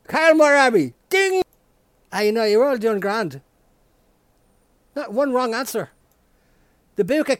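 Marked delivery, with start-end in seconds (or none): 1.1-1.4 high sung ding; mock game show ding; ding chime. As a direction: imitate the correct-answer bell.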